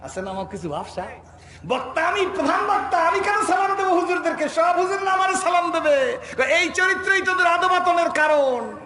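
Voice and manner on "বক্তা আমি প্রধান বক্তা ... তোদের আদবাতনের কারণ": high-pitched indignant boasting; mock self-importance. Preacher mocking a chief speaker who won't greet clerics, indignant boasting. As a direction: declaim it high like an offended dignitary.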